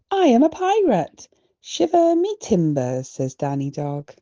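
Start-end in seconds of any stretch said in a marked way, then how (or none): 0.1-3.1 pirate voice. Acted. Child plays a pirate.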